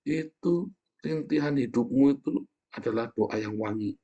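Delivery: ordinary talk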